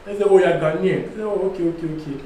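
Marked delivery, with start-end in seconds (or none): none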